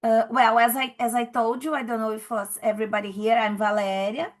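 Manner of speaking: normal talking voice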